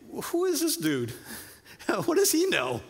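a lightly hammed-up disbelieving "who is this dude"; scoffing fishermen. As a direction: play a skeptical pro fisherman scoffing at the stranger. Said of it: "I could just imagine these professional fishermen, uh, saying".

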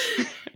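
a sudden squeaky giggle, way up high. Real, not acted. To her co-host about barely painting her nails, a high giggle. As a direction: giggle high and quick at the joke.

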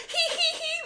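very high "hee hee hee"; squeaky put-on laughter; mock giggling. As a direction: imitate a group tittering. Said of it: "they were like".

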